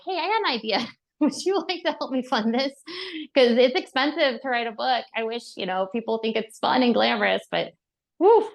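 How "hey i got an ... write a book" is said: high, hopeful, a little sheepish; sweet asking voice. Retold or imitated. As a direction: ask for money sweetly with a laugh. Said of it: "reached back out to her and said".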